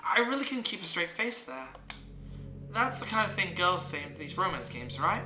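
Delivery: lightly voiced teasing game girl. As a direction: tease lightly as the game's girl. Reads teasing game character's lines.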